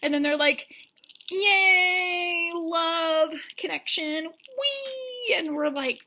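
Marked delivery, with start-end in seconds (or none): 1.3-5.3 drawn-out high squeals of delight; mock gleeful cheer. Couple celebrating their connection, mock gleeful. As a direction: cheer sweetly, ending on a high "wee". Mocking the couple's happy bonding.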